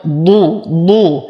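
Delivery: ضو with emphatic ض and fully rounded "u"; thick ض, complete rounded vowel in ضو; heavy ḍād. Shown as a demonstration. Teacher drilling the ending, heavy rounded ضو in separate bursts. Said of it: "وفخم الضاء مع إتمام الضم".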